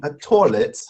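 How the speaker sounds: put-on English accent. Shown as a demonstration. Host repeats guest's word in English accent. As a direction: say it as an American doing English. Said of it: "I'll do an English accent".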